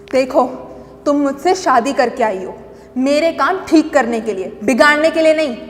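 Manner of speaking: loud domineering scolding; stern husband. Acted. Husband putting his wife in her place, harshly. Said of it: "तो उसने कहा".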